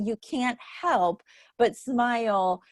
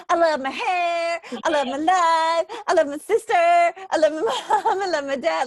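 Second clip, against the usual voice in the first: put-on exuberant little girl; 7 semitones higher, 5 dB louder than usual. Friend recalls curly-haired girl's viral video, exuberant child impression. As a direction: imitate a little girl proudly declaring her loves. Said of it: "the little girl with the curly hair and she's like".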